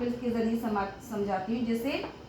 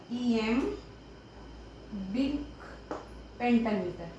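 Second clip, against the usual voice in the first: very slow, soft, drawn-out syllables; writing-out pace; 2 semitones lower, 6 dB quieter, much slower than usual. Real, not acted. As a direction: say it piece by piece as you write. Spelling it out while writing. (लिखते हुए धीरे-धीरे)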